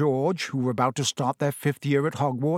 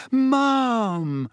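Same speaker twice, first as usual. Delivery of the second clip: high, hammed-up courtly greeting; bow to her in jest; mock gallant hello; 9 semitones higher than usual. A narrator's reading. Fred mock-bows to his mother.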